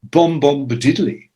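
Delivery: light mock Jamaican lilt; mock reggae greeting. Imitating posh reggae writer answering phone, light mock Jamaican lilt. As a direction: hum it like a lazy reggae hello. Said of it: "pick up the phone and go".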